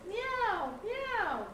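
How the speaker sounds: high, falling cat meows; two high mews sliding down. A narrator's reading. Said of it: "cried the cat".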